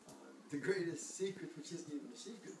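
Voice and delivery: ordinary delivery, nothing marked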